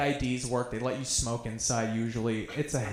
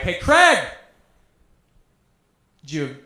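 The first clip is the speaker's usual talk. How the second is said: mock yell, pitched up on the opening name; dad yelling; 4 semitones higher, 6 dB louder than usual. Dad yells at Craig for the whole neighborhood to hear, mock yelling. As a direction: call out loud and high, imitating a heckling dad. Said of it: "He was yelling it".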